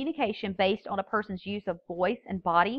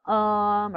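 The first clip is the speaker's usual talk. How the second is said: long held "um" on one pitch; drawn-out hesitation filler "um"; vocalized pause; 4 dB louder than usual. Shown as a demonstration. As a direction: fill the silence with a level, humming "um". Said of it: "such as".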